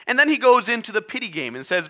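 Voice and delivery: usual speaking voice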